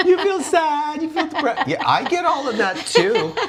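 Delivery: high, laughing mock whine; squeaky laughing parody of feelings; mock whiny voice.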